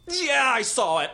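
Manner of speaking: high, slow, drawn-out admission; grudging mom. Retold or imitated. Mom admitting she saw son's commercial, slow grudging high voice. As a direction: concede it grudgingly as the mother. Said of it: "Like, she has to give up".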